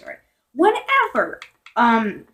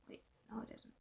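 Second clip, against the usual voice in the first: whisper; 33 dB quieter, faster than usual. Real, not acted. Aside correcting herself.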